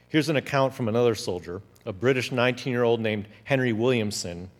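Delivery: usual speaking voice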